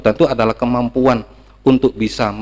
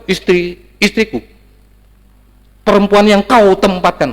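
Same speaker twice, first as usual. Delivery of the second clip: defensive blaming; 8 semitones higher, 3 dB louder than usual. Acted. Adam blames his wife.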